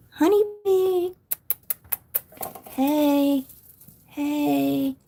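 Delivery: high, drawn-out pet-talk coo. Real, not acted. Owner calling honeybee the shy python, cooing. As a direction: coax the pet sweetly in a high voice.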